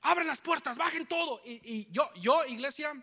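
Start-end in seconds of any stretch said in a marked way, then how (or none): 0.0-1.4 higher, shouted orders of an assailant; retold shouting. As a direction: bark the orders like a thug at the car. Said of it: "nos empiezan a gritar". (gritos imitados de un asaltante)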